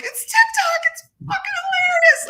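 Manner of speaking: very high, loud squeal; imitated hysterical laughter. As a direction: re-enact laughing to tears, words squeaking out way up high. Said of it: "I'm laughing hysterically, like, to the point of tears".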